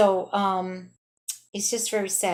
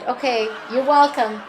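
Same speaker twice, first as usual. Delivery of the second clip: louder pointed thanks-chasing reply; loud sarcastic you're welcome; 4 semitones higher, 7 dB louder than usual. Retold or imitated. To the woman who gave no thanks, loud sarcastic retort. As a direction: say it loud so the whole elevator hears. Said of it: "I said it really loud".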